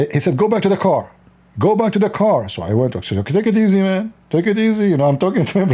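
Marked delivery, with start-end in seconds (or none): 0.4-2.5 higher-pitched imitated cop order; insistent mock command, voice lifted; retold police command. Retold policeman ordering driver back. 3.2-4.9 raised pitch, imitating a startled plea; mock-alarmed appeasing; retold placating protest. Retold driver placating agitated policeman.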